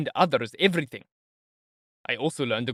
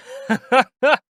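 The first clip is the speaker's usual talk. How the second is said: a high, loud teasing laugh; mocking laugh; 11 semitones higher, 8 dB louder than usual. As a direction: laugh at him as if it's all a game. Porfiry laughs after teasing question.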